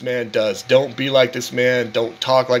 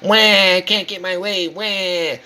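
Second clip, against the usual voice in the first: Tyree crybaby whine; 4 semitones higher than usual. Retold or imitated. Mocking a grown man as a bawling child, whiny wail. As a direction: whine it like a spoiled kid wailing. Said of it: "talks like this is because he used to cry".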